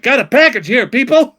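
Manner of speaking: high, loud hammed-up delivery guy call; Jim Carrey impression. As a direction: announce the package like a manic cartoon courier. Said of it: "looked like fucking Jim Carrey was like".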